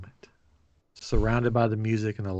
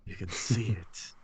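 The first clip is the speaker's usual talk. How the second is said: spooky legend; slower than usual. Pretend. Joking about the car left behind, mock-spooky storyteller. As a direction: tell it like a ghost story.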